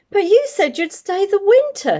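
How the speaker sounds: put-on a small girl pleading against the goodbye; little girl voice. Jenny protests to the bear.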